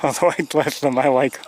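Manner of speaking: amused, laughter spilling into the words; loud, high, laughing delivery. Real, not acted. Amused about being bossed by geese.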